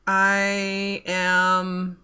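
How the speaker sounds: usual speaking voice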